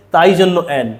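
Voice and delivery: ordinary talk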